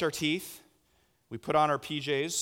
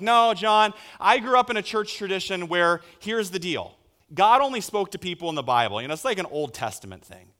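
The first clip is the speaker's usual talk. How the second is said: loud, raised-pitch objection; insistent, louder protest that settles at the end; protesting objector; 5 semitones higher, 7 dB louder than usual. Acted. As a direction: push back like a doubtful churchgoer. Voicing an objecting congregant.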